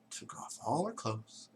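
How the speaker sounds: ordinary delivery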